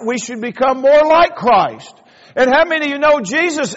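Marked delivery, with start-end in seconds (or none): none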